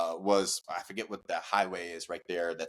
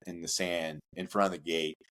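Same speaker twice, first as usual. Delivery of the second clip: usual speaking voice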